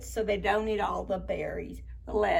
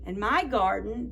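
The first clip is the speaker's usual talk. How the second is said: ordinary delivery, nothing marked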